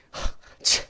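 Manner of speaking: breathy scornful huff; preacher imitating her sulky scoff at God. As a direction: scoff under your breath, sulking. Scoffing at God's silence.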